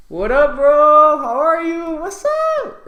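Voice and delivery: very high, drawn-out holler; best-friend greeting. Shown as a demonstration. Seeing your best friend, whooping excited shout. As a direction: yell it long and loud to your best buddy. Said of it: "if you see your best friend".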